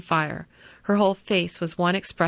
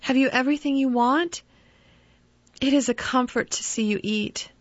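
higher, fond and fussing; doting mother; 6 semitones higher than usual. Acted. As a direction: fuss over him like a mother. Mother fussing over son.

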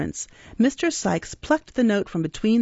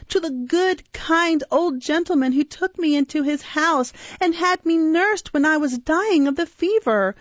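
passionate grief; 10 semitones higher than usual. A narrator's reading. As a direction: beg them desperately, close to tears. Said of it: "uttered with all the energy of passionate grief".

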